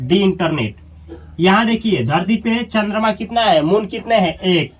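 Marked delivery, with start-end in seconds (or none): 0.1-0.7 "the internet" said as dee internet; long ee in "the" before "internet". Shown as a demonstration. Teacher reads article with internet, the pronounced dee. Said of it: "इसको पढ़ा जाएगा".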